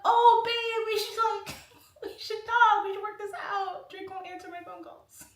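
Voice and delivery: high, whiny fake-crying plea; mock tearful pleading. Wife crawling back to husband after [name] dumps her, mock tearful pleading. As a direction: wail it through giggles, loud then fading.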